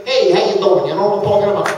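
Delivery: loud, turned-up New Jersey accent; hammed-up New Jersey greeting, loud; strong New Jersey accent. Shown as a demonstration. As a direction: lay the Jersey accent on thick. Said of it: "I can really turn it up".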